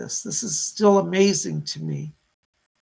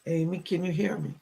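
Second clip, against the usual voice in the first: ordinary talk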